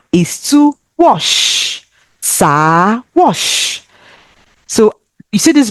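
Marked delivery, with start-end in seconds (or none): none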